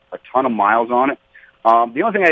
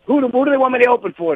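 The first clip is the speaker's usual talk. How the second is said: high, quick eager question; suddenly keen, asking in a rush; hammed-up curiosity, pitched way up; eager turnaround; 13 semitones higher, faster than usual. Acts out his sudden change of tune.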